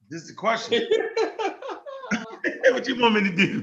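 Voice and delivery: talking through real laughter; giggling between words; laughing. Real, not acted. Laughing through his question.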